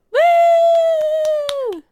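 high whooping cheer; excited cheer. Real, not acted. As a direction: whoop for the show. Cheer at intro.

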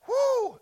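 high woo; short joyful cry; joyful whoop. Real, not acted. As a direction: whoop for joy. Preacher whoops.

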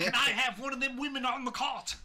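put-on high-pitched British accent, laughing; mock British accent. Topping co-host's joke about the film role, mock British accent. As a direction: play it as a silly British character. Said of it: "him talking in a British accent".

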